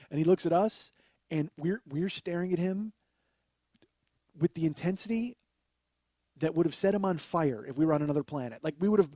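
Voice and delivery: ordinary talk, nothing marked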